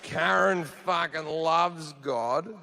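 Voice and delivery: slow, drawn-out groan of dismay; dismayed fan. Acted. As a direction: draw it out as the fan's dread sinks in. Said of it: "he's going".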